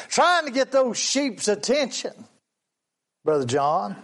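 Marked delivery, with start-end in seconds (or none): none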